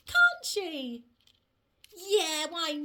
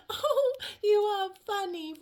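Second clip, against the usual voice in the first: plain reading voice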